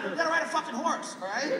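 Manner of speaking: ordinary talk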